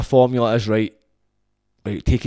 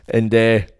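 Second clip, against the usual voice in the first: usual speaking voice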